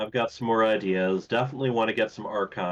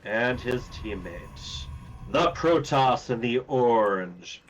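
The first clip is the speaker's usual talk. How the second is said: slow, drawn-out arena announcer; slower than usual. Pretend. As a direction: stretch each name like a big-event announcer. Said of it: "I like doing the announcer voice".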